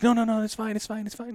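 high-pitched mimicked placating; anxious appeasing. Officers fear trouble over the bribe, imitated anxious appeasing. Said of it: "the panic shifted to them".

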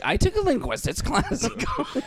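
high-pitched mock quote through laughter; high, silly mimicking voice; mocking Tyler. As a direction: laugh your way through a squeaky parody. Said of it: "Tyler's like".

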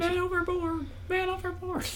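high, shouted alarm call; man overboard shout. Retold or imitated. Calling the alarm.

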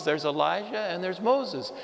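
ordinary talk, nothing marked

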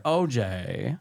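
lightly imitated lawyer, falling low at the end; hint of an actor playing a lawyer; John Travolta. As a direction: do a loose impression of a film-star lawyer. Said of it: "They almost sound like John Travolta".

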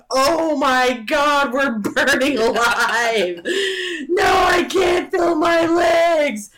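slow high hammed-up agony screaming; burning scream. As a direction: wail like trapped in a fire. Family screaming, burning alive.

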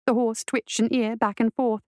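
usual speaking voice

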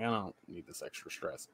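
low, quiet retold grumble; muted reluctance. Worker voicing private thought about extra stress, muted. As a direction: say it low and under your voice.

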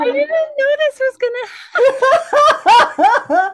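slow, choked laugh-cry; crying and laughing at once; laughing through tears. Real, not acted. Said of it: "Are you crying?".

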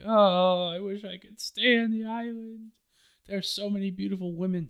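high, fast swooning whine; lovestruck whine. Retold or imitated. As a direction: pine like a smitten flirt. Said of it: "whine about wanting to stay".

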